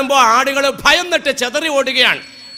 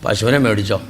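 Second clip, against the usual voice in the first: lower, sly dealer's sales pitch; cattle broker; 13 semitones lower than usual. Retold or imitated. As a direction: talk the buyer into the deal, pitched well down. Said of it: "അപ്പോൾ broker പറയേ".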